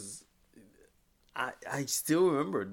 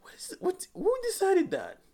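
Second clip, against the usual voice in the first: much higher, breathy to loud indignation; outraged disbelief; 5 dB louder than usual. Retold or imitated. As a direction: demand who did this, aghast. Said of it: "I'm like".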